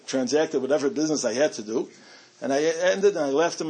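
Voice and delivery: normal talking voice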